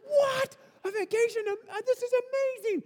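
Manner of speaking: very high, amazed cry; astonished. Retold or imitated. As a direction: squeal with disbelief. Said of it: "We're like".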